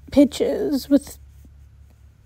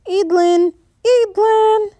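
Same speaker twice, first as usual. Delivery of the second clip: calling a name, rising higher; 7 semitones higher than usual. A narrator's reading. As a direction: call her name across the field. Someone calling her name.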